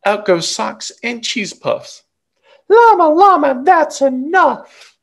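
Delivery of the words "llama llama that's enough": high, loud exasperated mama; Mama scolding. Acted. Mother cuts off her child's tantrum, high loud scolding. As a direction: stop the tantrum, firm and loud.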